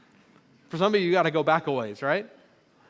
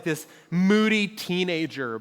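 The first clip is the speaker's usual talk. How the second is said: usual speaking voice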